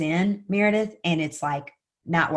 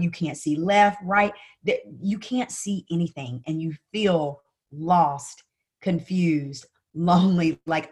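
ordinary delivery

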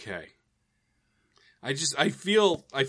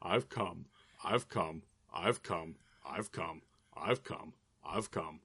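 quieter and lower each time, trailing off; fading weary repetition; 8 semitones lower, 10 dB quieter, slower than usual. Pretend. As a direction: let it fade away, drained. Repeated weary mock moaning.

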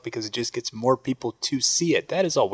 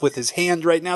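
normal talking voice

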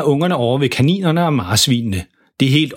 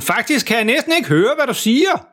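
high-pitched, oblivious yell; deafened shouting; 11 semitones higher than usual. A narrator's reading. Deafened dad denies shouting, high oblivious yell. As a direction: protest in a high holler. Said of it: "der står og råber sådan".

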